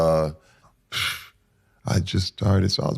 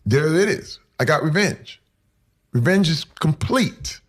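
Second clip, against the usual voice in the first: mock triumph; 8 semitones higher than usual. Acts out his expected triumph at revenge.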